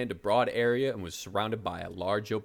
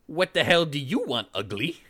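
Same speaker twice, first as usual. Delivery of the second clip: hostile witch; 5 semitones higher, 4 dB louder than usual. Acted. Witch confronts the barbarian.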